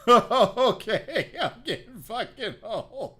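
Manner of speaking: laughing through the words. Real, not acted. Comic laughing at being old. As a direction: crack up while saying it.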